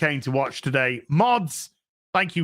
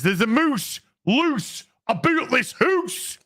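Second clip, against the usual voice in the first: put-on Scottish accent; 7 semitones higher, slower than usual. Reading a viewer's chat in a hammed Scottish accent. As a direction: boom it out in a mock Scottish brogue. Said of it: "grade that Scottish accent".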